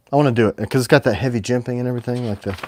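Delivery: normal talking voice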